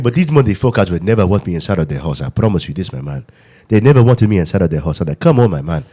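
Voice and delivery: put-on African accent, animated and insistent. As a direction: plead your case in a mock African accent. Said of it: "If you just talk like that".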